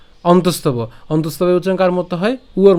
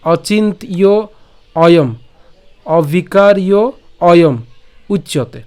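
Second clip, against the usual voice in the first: careful recitation with a pause after each word; word-by-word demonstration; 4 dB louder, slower than usual. Shown as a demonstration. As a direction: separate Achintya, অভিকার্য and Ayam for learners. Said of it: "আমি আবার বলছি".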